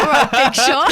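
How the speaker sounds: laughing mock-impressed put-down; teasing mockery. Recruiter ribbing the host about billing, teasing mockery.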